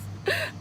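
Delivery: a quick high-pitched giggle. Real, not acted. Giggling at her own confession. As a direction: break into a high little laugh.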